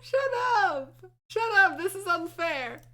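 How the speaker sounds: loud and laughing, mock outraged; playful outraged shout. Real, not acted. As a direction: yell it through a laugh. Protesting while laughing.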